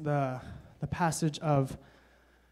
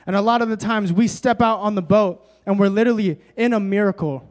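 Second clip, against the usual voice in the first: loud, raised preaching voice; forceful preaching; 4 semitones higher, 7 dB louder than usual. Acted. Preacher telling the congregation where Peter messed up, forceful preaching. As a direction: preach it with punchy emphasis.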